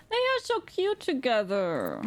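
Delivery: high, slow gushing coo; adoring gush. Real, not acted. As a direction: coo over an adorable couple, drawn out. Streamer gushes over the couple.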